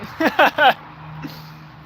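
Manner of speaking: airy high-pitched laugh; high breathy laugh. Real, not acted. Laughs at own joke.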